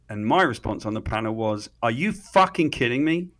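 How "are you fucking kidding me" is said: higher-pitched, mock-outraged outburst; retold outraged yell. British immigrant panelist to woman from L A, outraged. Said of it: "I yelled at this lady".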